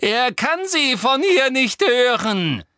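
high, drawn-out, loud call; loud shout. A narrator's reading. Boy tells the witch her master can't hear, shouting. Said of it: "rief Harry".

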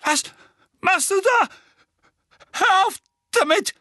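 high, loud panicked cry; a scared boy begging in the dark; frightened Dudley. A narrator's reading. Dudley, terrified in the dark, cries out to Harry. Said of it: "Dudleys angsterfüllte Stimme".

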